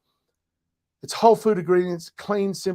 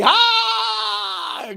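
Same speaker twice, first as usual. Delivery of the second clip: very high, loud, long cry; triumphant "aah" shout; 5 dB louder than usual. Real, not acted. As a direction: cry out as the joke lands. Gets the pun.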